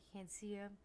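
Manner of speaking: normal talking voice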